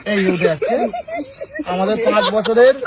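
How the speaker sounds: ordinary talk, nothing marked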